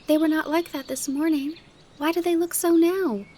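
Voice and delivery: put-on high, puzzled child protesting; high-pitched upset bewilderment; surprised shock. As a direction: demand why, like a dismayed little girl. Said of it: "exclaimed Heidi in surprised shock".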